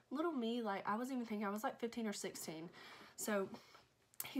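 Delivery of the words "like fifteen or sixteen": plain reading voice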